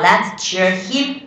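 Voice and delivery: let's cheer him up, slow and spelled out; slow example. Shown as a demonstration. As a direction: read let's cheer him up slowly, word by word. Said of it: "比如说".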